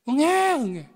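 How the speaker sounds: high mock baby wail; baby crying. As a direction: imitate a wailing infant. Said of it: "그냥 울어요". (아기 울음 흉내, 높게)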